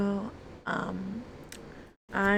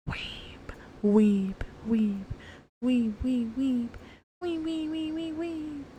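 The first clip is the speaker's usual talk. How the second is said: playful chirping; 4 semitones higher than usual. Pretend. Playful repeated chirping sound.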